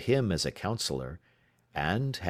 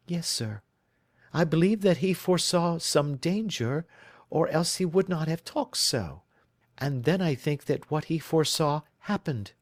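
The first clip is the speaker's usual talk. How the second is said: put-on higher, earnest young lady pleading her case; a worried bride telling her story; anxious young woman; 8 semitones higher than usual. Miss Sutherland to Holmes, higher and earnest. Client answers.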